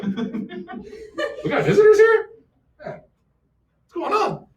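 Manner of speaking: slow, high, laughing startled voice; drawn out high and amazed, through a laugh; startled native. Retold or imitated. Teacher voicing a startled native for the class, slow and high. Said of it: "He's like".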